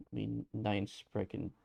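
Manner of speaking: slow, hammed-up bad German accent; a hint of mock German accent. Joking about Von Kaiser, in a slow mock German accent. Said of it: "in a bad German accent".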